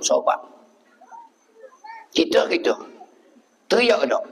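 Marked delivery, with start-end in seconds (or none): none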